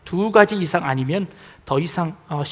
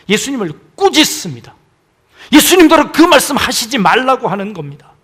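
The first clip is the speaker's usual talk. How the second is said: ordinary talk, nothing marked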